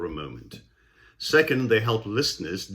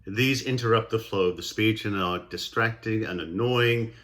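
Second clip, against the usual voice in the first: ordinary talk, nothing marked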